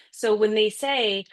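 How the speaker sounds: ordinary talk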